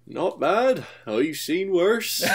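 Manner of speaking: high put-on voice, unhurried; dry put-down in a high voice; understated Duck. Duck to the newcomer, understated wit. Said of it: "they come in and Duck is like".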